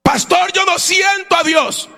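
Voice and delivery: high-pitched shouted protest; acted wail of a struggling believer; anguished complaint. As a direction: cry out to the pastor in the congregant's place. Voices a congregant's complaint to pastor.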